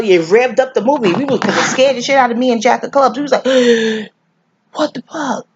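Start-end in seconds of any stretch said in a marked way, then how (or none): 3.5-4.0 sharp mock gasp of fright; retold scared gasp. Acts out their scare at film. 4.7-5.5 high mock-shocked reaction; retold shock. Acts out scared reaction to film.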